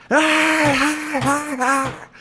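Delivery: squeaky high-pitched "ah, ah" laugh imitation; high mock veteran cackle. Imitating the veteran's laugh at his joke, squeaky and high. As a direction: mimic an old vet cackling high. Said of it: "he was like".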